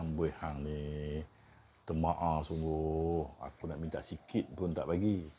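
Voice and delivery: slow teasing Kedah complaint; drawn-out amboi, mock scolding stingy friend; kedah malay accent. Shown as a demonstration. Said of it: "Kamu ni kedekut sangat".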